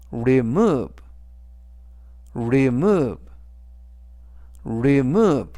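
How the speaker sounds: "remove" with a strong, falling MOVE; stress on the O. Shown as a demonstration. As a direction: model "remove" for learners, punching the second syllable. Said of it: "이때 강세는 알파벳 O의 강세가". ("remove"의 O에 강세를 주어 또박또박)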